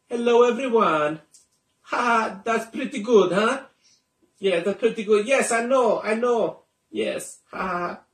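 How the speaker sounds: high, hammy Italian chef voice; Italian accent. Acted. Magician voicing his puppet for viewers in Italian accent. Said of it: "an Italian accent".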